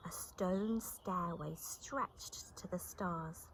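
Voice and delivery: ordinary delivery, nothing marked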